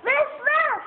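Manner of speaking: little yappy woof; puppy bark. Pretend. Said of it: "What does the puppy say?".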